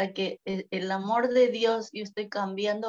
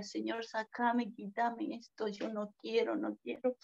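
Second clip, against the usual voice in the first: soft, broken plea to God, slowing at the start; quietly begging, as if holding back tears; retold tearful plea; 8 dB quieter than usual. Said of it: "Y yo lloraba". (súplica suave y llorosa a Dios)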